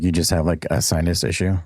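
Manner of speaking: usual speaking voice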